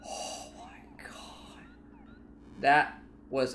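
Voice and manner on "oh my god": slow breathless whisper of relief. Real, not acted. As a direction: breathe it out in disbelief. After a close call.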